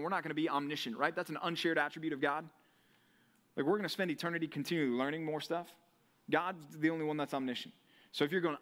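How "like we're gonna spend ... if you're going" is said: normal talking voice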